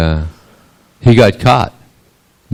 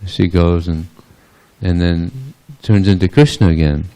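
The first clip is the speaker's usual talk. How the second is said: ordinary delivery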